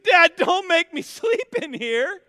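high, loud whine breaking into a laugh; pleading kid. Retold or imitated. Son begging dad not to leave him alone, pleading. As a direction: beg like a scared six-year-old, laughing through it.

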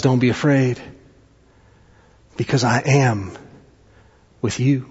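quiet, low-voiced reassurance; reassuring. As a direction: comfort them softly, pausing between phrases. Gentle reassurance to those facing storms.

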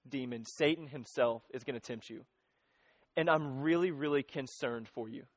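low, soft and earnest, as Jesus warning Peter; Jesus quietly confiding his worry to Peter; tender, grave Jesus. Retold or imitated. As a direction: lean in and speak low with deep concern.

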